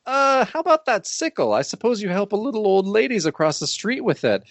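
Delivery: high, teasing cop retort; acting out a sarcastic policeman; mocking sarcasm. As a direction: needle him with mock-innocent supposition. Acts cops mocking Hangman's sickle.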